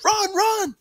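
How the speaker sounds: very high, loud mock-urgent cry; excited high replay of a shout; re-enacted yell. A narrator's reading. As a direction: act out yelling to flee. Henry re-enacts his yell.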